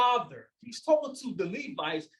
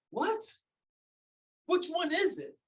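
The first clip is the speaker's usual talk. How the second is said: mock disbelief; 8 semitones higher than usual. Mocking the apologists' claim.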